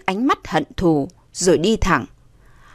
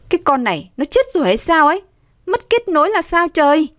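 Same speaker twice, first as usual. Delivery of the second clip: high, fast complaint; exasperated; 8 semitones higher, faster than usual. A narrator's reading. Woman failing to reach her friend, exasperated. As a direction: vent disbelief at the dead line.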